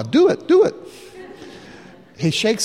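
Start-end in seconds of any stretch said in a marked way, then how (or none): 0.0-0.7 high, excited laughing push; giggly high cheer; laughing urge. Retold or imitated. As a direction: egg him on with a laugh. Urging him, laughing.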